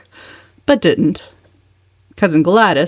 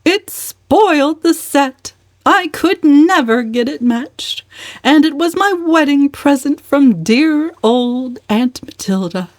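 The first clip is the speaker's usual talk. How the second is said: lamenting aunt; 8 semitones higher than usual. Acted. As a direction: mourn the lost teaspoon in a high, pinched voice. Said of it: "lamented the fact".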